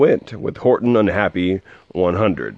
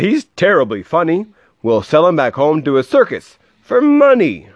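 high, louder excited chatter; amazed hunters; 8 semitones higher, 4 dB louder than usual. Acted. Hunters marvelling at the elephant, high excited voices. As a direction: babble with amazement at the find.

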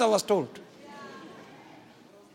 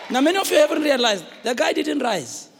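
ordinary talk, nothing marked

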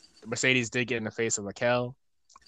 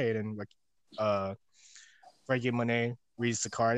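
ordinary delivery

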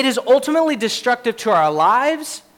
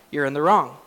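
ordinary talk

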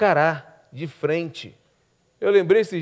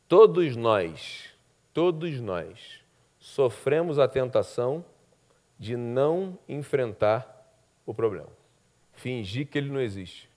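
lower, softer, very slow; solemn and slow; 6 semitones lower, 5 dB quieter, slower than usual. Acted. Pastor confessing we all avoid problems, solemn and slow. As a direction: bring it down gravely.

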